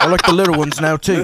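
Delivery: British impression through laughter; British accent. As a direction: imitate a British pal while cracking up. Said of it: "The British guy".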